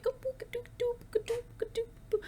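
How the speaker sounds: high, soft cooing at a puppy; cute baby-talk noises. Shown as a demonstration. Vlogger demoing the noises she made at her painting, high soft cooing. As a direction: coo like you're talking to a cute dog. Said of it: "like, cute noises".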